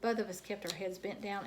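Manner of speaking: ordinary delivery, nothing marked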